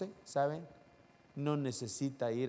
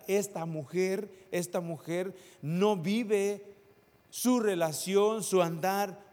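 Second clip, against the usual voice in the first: raised, higher preaching voice, slowing to press each phrase; preaching at full force; 8 semitones higher, 6 dB louder than usual. Acted. Pastor urging the congregation, preaching at full force. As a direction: preach it out to the whole church.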